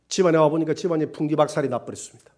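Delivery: usual speaking voice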